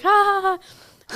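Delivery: high, slow mock laugh; forced fake laugh. Narrator fakes a laugh at her friend's confession, very high. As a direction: laugh it off, clearly faking.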